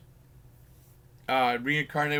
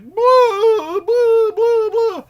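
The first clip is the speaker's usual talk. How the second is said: loud high hammed-up blah blah, much higher and louder; mock Dracula blah; 13 dB louder, much slower than usual. Mocking the villain's Dracula cape, loud high blahs. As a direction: flap the cape and cry blah. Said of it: "like Dracula".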